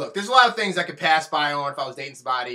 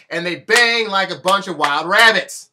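normal talking voice

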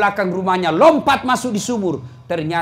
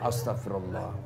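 murmured istighfar; 12 semitones lower, 14 dB quieter than usual. Shown as a demonstration. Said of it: "Kau lihat cewek cantik".

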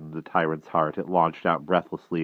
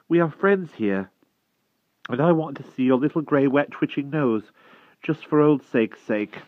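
put-on higher, gentle boy's voice; lightly voiced coaxing boy; 10 semitones higher, 4 dB louder than usual. A narrator's reading. The Wart reassuring the frightened hedgehog, lightly higher voice. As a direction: lightly coax a scared creature.

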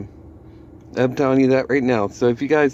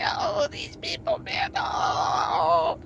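very high, softer whiny mock voice; mock whining; 7 dB quieter, much slower than usual. Vlogger mimicking Mickie complaining, high mock whine. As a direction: whine it like a crybaby, pitched way up. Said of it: "It's like".

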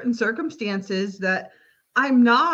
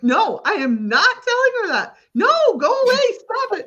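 arguing with spirits; 12 semitones higher, 3 dB louder than usual. Retold or imitated. Arguing out loud with guides.

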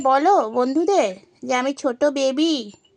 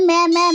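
mock bleat; 2 semitones higher, 3 dB louder, faster than usual. Mocking the pet's bleats.

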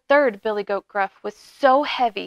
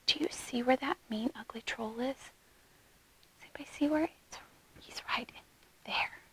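hushed whisper to the listeners; whispered aside; 15 dB quieter than usual. Real, not acted. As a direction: whisper it like a secret question. Quiet aside to the young listeners.

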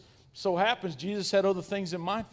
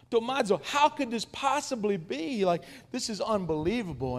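astonished; 6 semitones higher than usual. Retold or imitated. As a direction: gasp out the disbelief. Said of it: "so full of fish".

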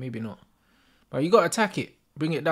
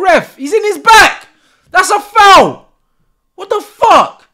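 high, very loud outrage; furious shout; 8 semitones higher, 8 dB louder than usual. Real, not acted. Fan demanding a foul from the ref, furious shout.

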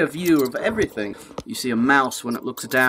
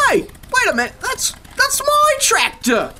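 rising high, stunned voice; sudden realization. A narrator's reading. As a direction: catch yourself in shock. Farmer realizes it's his tractor.

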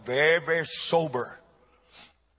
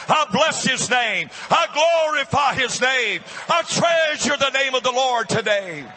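high-pitched, driving pulpit proclamation; full-force preaching; 7 semitones higher, 3 dB louder than usual. Real, not acted. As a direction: shout praise to the congregation in rising fervor.

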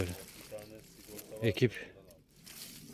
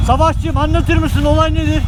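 loud high playful call; 11 dB louder than usual. Real, not acted. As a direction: shout a question across. Calling to friend, joking. (yüksek sesle seslenme)

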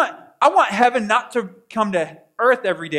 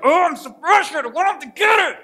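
frustrated outburst; 9 semitones higher than usual. Retold or imitated. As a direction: act out the exasperated believer, half shouting. Mocking frustrated believer.

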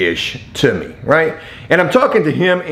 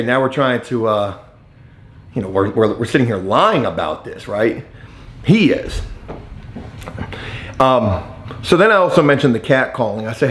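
usual speaking voice

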